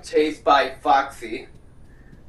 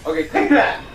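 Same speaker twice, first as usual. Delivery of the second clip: triumphant; 4 semitones higher, 6 dB louder than usual. Real, not acted. Fighting back in game.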